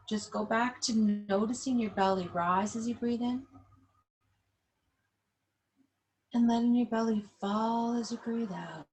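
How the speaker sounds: calm, unhurried yoga instructor cueing the breath; guided breathing. Acted. Yoga teacher guiding seated class through belly breathing, calm guided voice.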